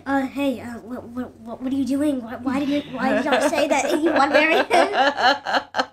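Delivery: mock reporter badgering. Reporters to Nicole at stadium, loud pushy laughing questions. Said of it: "they were like".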